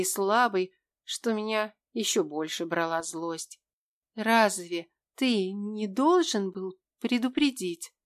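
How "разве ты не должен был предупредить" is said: struggling to get words out, slow; strained, each consonant hard; с трудом. Acted. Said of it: "Это предложение я произнесла с трудом". (через силу, медленно)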